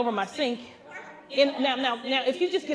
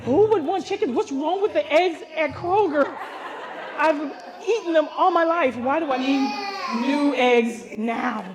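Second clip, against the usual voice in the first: loud high exasperated rant; sassy disbelief; incredulous complaining; 4 semitones higher, 4 dB louder than usual. Retold or imitated. City girl complaining.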